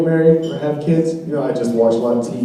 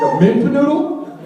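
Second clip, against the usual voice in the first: confused question; 12 semitones higher than usual. Retold or imitated. Mishears dog breed.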